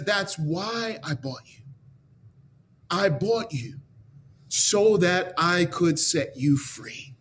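tender reassurance. A narrator's reading. Miner frees the woman tenderly.

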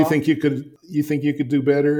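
defiant challenge. Retold or imitated. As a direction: throw down the gauntlet. Challenging the mentor.

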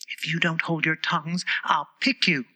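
ordinary delivery, nothing marked

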